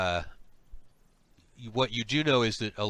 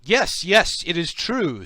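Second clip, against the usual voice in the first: higher, louder, insistent agreement; eager confirmation; 6 semitones higher, 8 dB louder, slower than usual. Acted. Slug guide vouching for the party, eager confirmation. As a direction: jump in eagerly to back up the lie.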